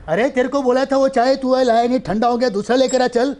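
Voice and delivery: put-on loud, high yell at the spot boy. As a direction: bark a scolding across the set, loud and high. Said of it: "चिल्ला रहा हूँ".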